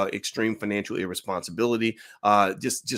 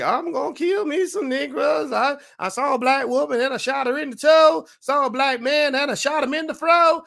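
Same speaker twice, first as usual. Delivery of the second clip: mocking country singer; 13 semitones higher, 5 dB louder than usual. Mocking a country singer, high and loud. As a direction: belt it out like a mock hillbilly singer. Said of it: "he's like".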